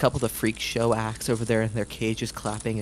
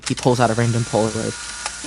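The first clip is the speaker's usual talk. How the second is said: normal talking voice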